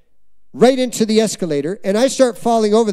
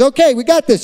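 put-on confident teen; 5 semitones higher, 5 dB louder than usual. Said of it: "He says".

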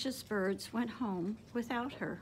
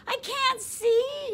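much higher, louder frightened yell, slowed; 13 semitones higher, 8 dB louder, slower than usual. A narrator's reading. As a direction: panic in the dark and cry out. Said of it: "yelled Pip".

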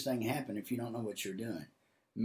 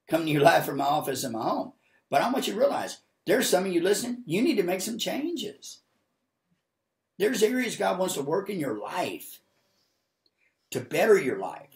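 raised, loud, urgent exhortation; emphatic preaching; 6 semitones higher, 7 dB louder than usual. Real, not acted. Preacher exhorting Facebook viewers to make changes, emphatic. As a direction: press the listeners with a high, forceful voice.

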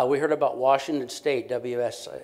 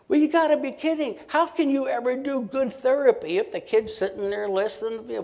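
high, loud and fast mock outrage; incredulous objection; 11 semitones higher, 4 dB louder than usual. Therapist scoffing at engaging a kid with earphones, incredulous. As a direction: scoff at the idea like it's absurd.

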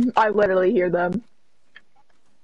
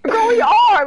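excited; 12 semitones higher, 5 dB louder, slower than usual. Real, not acted. High loud retort.

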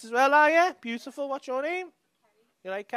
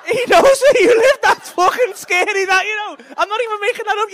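higher and louder, projecting to the back of the room; calling out loud; 13 semitones higher, 10 dB louder than usual. Real, not acted. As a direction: call it out to someone far off in the dark. Shouting across at distant heckler.

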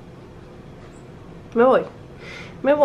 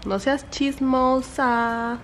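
slow, drawn-out singsong scolding; drawn-out mock telling-off; playful chiding; 5 semitones higher, much slower than usual. Real, not acted. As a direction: tease the pet, stretching every vowel.

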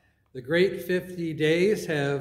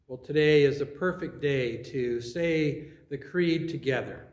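usual speaking voice

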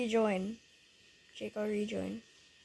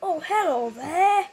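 mock greeting; 6 semitones higher, 8 dB louder than usual. Greeting a creepy figure.

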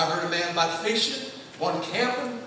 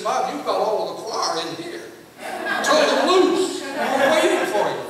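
retold, louder and higher with a laugh; urgent call; 4 semitones higher, 6 dB louder than usual. Superintendent calling the preacher to release the choir, urgent. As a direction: hurry him along, half laughing.

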